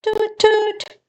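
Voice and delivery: high tooting horn call; boat horn toot. Acted. Narrator imitating a boat horn, high toots.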